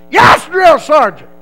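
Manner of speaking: slow, high recruit shout; sounding off. Retold or imitated. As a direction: holler a drilled military reply.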